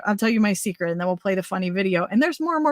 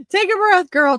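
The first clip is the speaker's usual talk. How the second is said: amused self-scolding; 9 semitones higher, 6 dB louder than usual. Real, not acted. Talking to herself, laughing.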